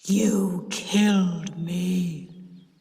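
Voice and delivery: very slow, drawn-out ghostly accusation; deep, terrible voice. A narrator's reading. Sis accusing her aunt through the pipe, deep terrible voice. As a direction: intone it deep like the dead. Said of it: "in a deep, terrible voice".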